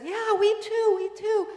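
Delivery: put-on high, loud and eager like a young girl; little daughter's eager voice. Mother retelling how the younger daughter eagerly agreed, high child's voice. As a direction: chime in as the excited youngest child. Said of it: "And then the younger said".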